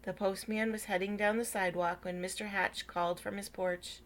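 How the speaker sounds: ordinary delivery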